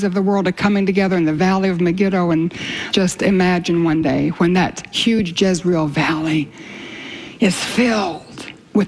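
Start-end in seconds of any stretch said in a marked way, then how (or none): none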